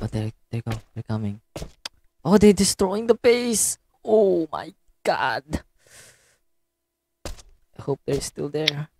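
soft hushed worry. Real, not acted. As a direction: keep it low, enemies near. Hushed while enemies near.

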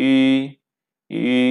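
ই rising like a question, Chinese second tone; Mandarin second tone. Shown as a demonstration. Teacher demonstrates the rising tone on ই. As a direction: slide ই upward. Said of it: "second tone, ই".

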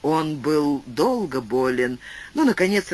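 ordinary delivery

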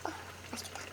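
breathy hushed whisper. Real, not acted. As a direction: breathe the words in awe. Quiet awe while eating.

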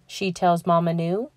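ordinary delivery, nothing marked

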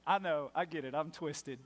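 usual speaking voice

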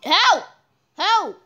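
high loud help calls; mock drowning cries. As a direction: yell as if drowning. Pretend drowning.

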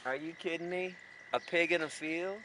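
ordinary delivery

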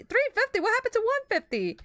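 high indignant disbelief; outraged surprise. Real, not acted. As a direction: squeal the price in shock. Outraged at pizza price.

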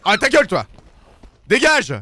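crie plus haut et plus fort, furieux; engueulade furieuse. Real, not acted. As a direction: chasse l'oiseau à grands cris. Engueule la cigogne dans le jeu. (hurle sur la cigogne pour la chasser)

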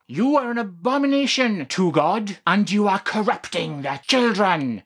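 preacher voice. Acted. Vlogger voices the hate message as a loud preacher. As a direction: thunder it at the sinner. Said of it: "I'm doing my best preacher voice here".

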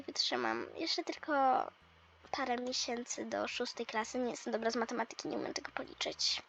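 fast, soft whisper to herself; shy schoolgirl whispering over her maths; whispering under her breath. Acted. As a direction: mumble it to yourself so no one hears. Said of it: "sobie szepcze coś pod nosem".

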